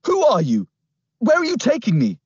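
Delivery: high, loud panicked shouts; shouted. Acted. Kidnapped boy shouting at the girl on the scooter. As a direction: yell at her to stop. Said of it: "I shouted".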